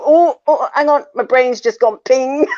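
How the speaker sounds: high, loud flustered outburst; mock overwhelm. Listener swamped by a fast talker, comically flustered. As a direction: play up a brain overload, half laughing. Acts out her brain being overloaded.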